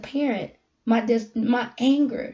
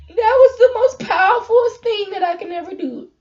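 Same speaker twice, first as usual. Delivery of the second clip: choking up while saying it; crying; 9 semitones higher, 8 dB louder than usual. Real, not acted. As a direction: voice breaking into tears, loud and high. Emotional confession, crying heard.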